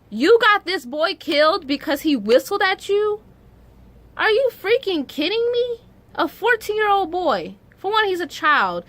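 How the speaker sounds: high, loud, furious disbelief; outraged disbelief. Real, not acted. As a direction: let the outrage explode upward. Outrage at the woman's lie.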